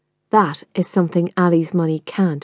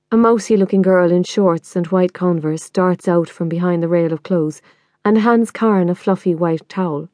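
ordinary delivery

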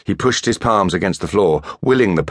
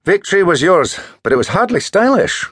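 higher, louder teasing friend; chiding schoolboy; 13 semitones higher, 4 dB louder than usual. A narrator's reading. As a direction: prod him to join. Marcus teasing voice.

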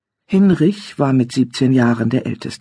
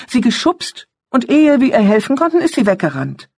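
put-on high, fast, eager boy; young boy voice; 10 semitones higher, 3 dB louder than usual. A narrator's reading. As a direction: rush it out like an excited boy.

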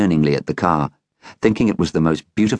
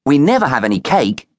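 put-on boy's voice; 8 semitones higher, 3 dB louder than usual. A narrator's reading. Boy adds to excuse.

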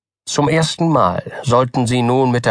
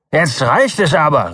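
angry outburst; 4 semitones higher than usual. A narrator's reading. Doktor Keene loses patience with Button, angry outburst. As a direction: cut him off in anger. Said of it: "rief Doktor Keene voll Wut".

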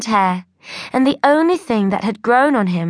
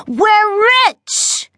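very high, loud, thrilled yell; excited cry; 11 semitones higher, 4 dB louder than usual. A narrator's reading. Brother waving a big offer letter, thrilled yell. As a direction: whoop it out like you just won. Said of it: "cried Ty".

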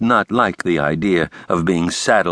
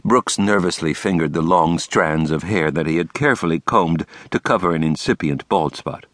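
usual speaking voice

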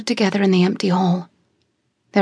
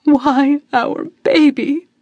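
bawling; 4 semitones higher, 3 dB louder, slower than usual. Acted. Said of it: "Mother bawled".

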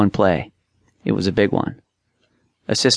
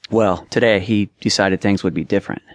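usual speaking voice

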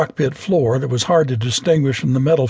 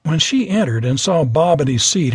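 ordinary delivery

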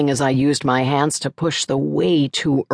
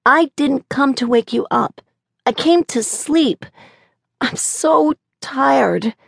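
put-on sleepy child voice; 10 semitones higher than usual. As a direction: sound worn out like a sleepy kid. Tired child in the dark.

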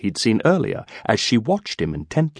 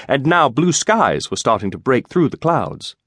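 plain reading voice

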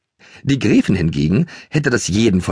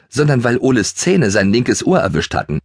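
plain reading voice

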